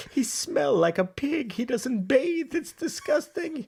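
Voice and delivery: put-on slow, high-pitched Italian-accented complaint; hammed-up high Italian old lady; old Italian woman. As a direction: drag out the disgust as an old Italian wife. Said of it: "she brings the old Italian woman in".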